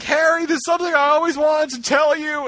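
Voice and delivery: high, breathless confession voice; melodramatic heroine. Retold or imitated. Mocking the fic for co-hosts, a high melodramatic confession. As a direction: ham up a last-moment love confession. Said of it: "And she's like".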